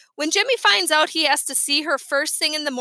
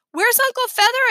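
alarmed; 9 semitones higher, 5 dB louder than usual. A narrator's reading. Alarmed question to Fudge.

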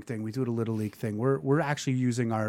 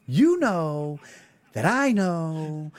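louder, higher teasing chant; taunting sing-song; 3 semitones higher, 5 dB louder, much slower than usual. Real, not acted. Calling out fake online people, loud taunting chant. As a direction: lay it on like a playground taunt.

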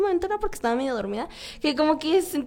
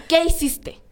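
louder, pointed accusing question; stern tone; 2 semitones higher, 4 dB louder, slower than usual. Retold or imitated. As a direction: ask it like a suspicious mother. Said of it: "pero con este tono".

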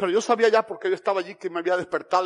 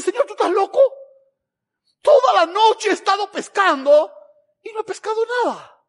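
high, loud, incredulous protest; exasperated protest; 12 semitones higher, 9 dB louder than usual. Acted. Peter objecting to Jesus after a fruitless night, exasperated protest. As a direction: protest loudly, pitched right up.

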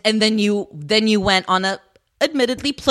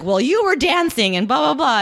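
higher, exaggerated comeback; mock defensive retort; 6 semitones higher than usual. She answers his accusation in their retold fight, mock defensive. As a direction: argue back in a sing-song imitation.

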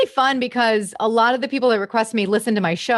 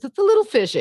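much higher, pinched voice; 10 semitones higher, faster than usual. Shown as a demonstration. Impressionist demos [name]'s fishy face voice, high. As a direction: pull the face and squeeze it out high. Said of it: "it's kinda like this".

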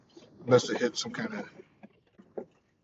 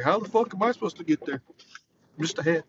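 higher, laughing through the lines; a lightly voiced baffled head, cracking up; talking head character; 5 semitones higher, 3 dB louder than usual. Quoting the councilman head from the Mighty Boosh, laughing. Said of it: "He's like".

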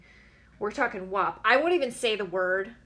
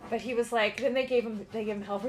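ordinary talk

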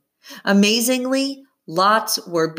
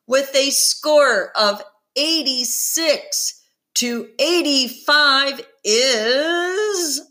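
big showy announcer, higher pitch; game show host; 8 semitones higher than usual. Acted. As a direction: build suspense like a TV host. Host announces contest winner.

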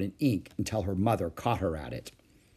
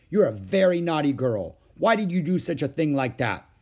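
scolding mother; 5 semitones higher, 5 dB louder than usual. Acted. Mother scolds naughty child.

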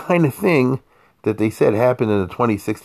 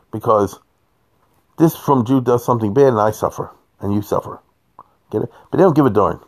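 normal talking voice

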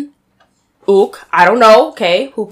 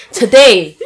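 normal talking voice

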